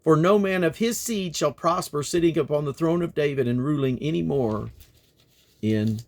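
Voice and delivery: prophetic reading. Acted. Reader voicing God's judgment, grave declaiming. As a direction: proclaim judgment with rising force.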